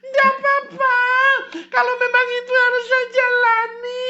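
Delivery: very high, wailing, tearful; hammed-up sobbing appeal to the president; mock crying plea. [name] wails a tearful plea to Pak Jokowi. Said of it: "kenapa kamu nangis".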